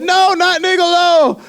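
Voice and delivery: high, drawn-out cry of protest; mock dismayed wail. Girlfriend protesting at the news, in a mock wail. Girlfriend's upset reply, acted.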